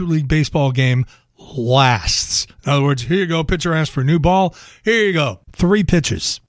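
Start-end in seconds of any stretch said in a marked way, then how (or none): none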